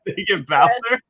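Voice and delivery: a playful high voice for the quote; quoting in a put-on voice. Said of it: "He said".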